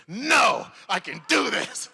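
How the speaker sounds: mock defiant shout from a laughing co-star; mock determined shout. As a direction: insist you won't crack, loudly. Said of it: "He goes like this".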